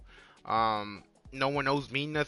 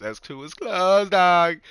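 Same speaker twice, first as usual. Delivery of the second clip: loud call; 5 semitones higher, 9 dB louder, slower than usual. Retold or imitated. Repeating a friend's loud call.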